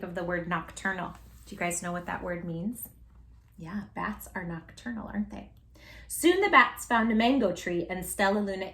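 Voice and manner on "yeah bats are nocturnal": usual speaking voice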